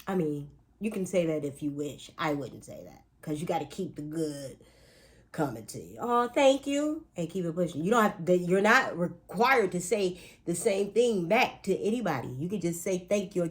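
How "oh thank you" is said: high, drawn-out thanks; polite mock reply. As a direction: sweetly act out a slow, high thank-you.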